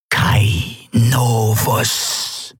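very slow, drawn-out otherworldly address; Sylravan. Acted. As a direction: stretch the name out ominously. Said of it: "sagte Sylravan". (unheimlich gedehnte Wesensstimme)

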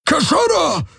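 hohes, angespanntes Gebrüll einer fremden Spezies; Brüllen. A narrator's reading. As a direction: jemanden alarmiert anbrüllen. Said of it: "brüllte er sie an". (hoch herausgebrüllt)

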